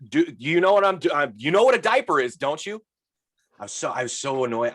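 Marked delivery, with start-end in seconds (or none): 0.1-2.8 exasperated talking down. Retold or imitated. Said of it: "pointed, like".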